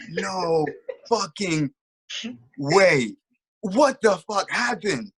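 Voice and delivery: slow, drawn-out words with pauses; utter disbelief. Retold or imitated. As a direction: let each stunned word hang. Retold disbelief at knockout.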